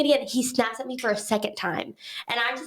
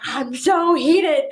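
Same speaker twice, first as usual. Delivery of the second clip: slow, loud, drawn-out I'm so heated; mock heated; 4 semitones higher, 6 dB louder, much slower than usual. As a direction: stretch it out like you're seething. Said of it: "And I'm texting her".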